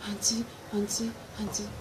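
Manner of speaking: soft unvoiced whisper. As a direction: whisper her awake gently. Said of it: "She now said".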